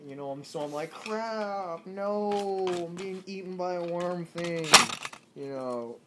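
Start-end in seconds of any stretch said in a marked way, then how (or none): 0.9-4.7 raised, drawn-out wail; mock horror. Man being swallowed by a giant worm, wailing. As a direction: wail it in hammed-up despair.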